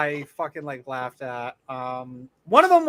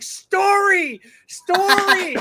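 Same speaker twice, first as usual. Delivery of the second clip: high, loud chant of story; yelling like an excited kid; 13 semitones higher, 7 dB louder, much slower than usual. Retold or imitated. Kids shouting at a player for a story. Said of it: "All the kids were like".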